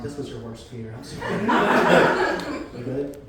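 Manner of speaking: ordinary talk, nothing marked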